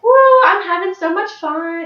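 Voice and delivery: loud, high whoop of imitated delight; gleeful whoop. Fearless child yelling from the slingshot ride, imitated glee. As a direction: whoop it up like a thrilled kid.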